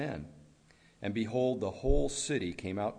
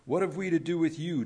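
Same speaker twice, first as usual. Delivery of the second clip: raised, higher and louder cry; 7 semitones higher, 5 dB louder than usual. A narrator's reading. Demons confront Jesus among the tombs, crying out. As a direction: read it as an understated outcry. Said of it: "they cried out".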